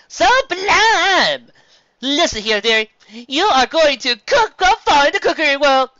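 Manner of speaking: high, loud, theatrical; grand dame dripping praise; gushing posh mare. Acted. Sapphire Swords praising Carrot Top, high and gushing.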